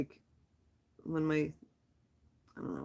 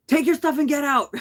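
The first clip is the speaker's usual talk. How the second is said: higher, louder mock yell of the rude attendant; retold yell; 7 semitones higher, 5 dB louder than usual. Retelling how the laundromat guy yelled at her, mock yell. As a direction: bark it like the grumpy laundromat guy. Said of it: "He yelled at me".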